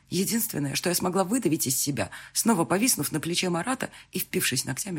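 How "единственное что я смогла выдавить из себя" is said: ordinary delivery, nothing marked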